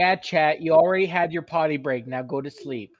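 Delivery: loud mock parent. Parent voice to chat.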